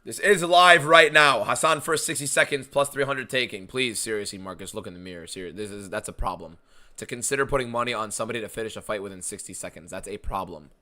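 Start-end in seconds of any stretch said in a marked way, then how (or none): 3.7-10.7 quiet. Real, not acted. Scolding chat viewer quietly.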